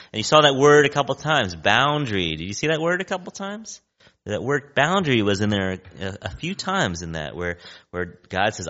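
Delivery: ordinary talk, nothing marked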